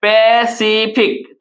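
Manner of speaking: पैसिफिक very slowly, pe, see, fik; पैसिफिक drawn out in three separate beats; pronunciation demo. Shown as a demonstration. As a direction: stretch each syllable of पैसिफिक. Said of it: "हम लोग क्या उच्चारण करते हैं".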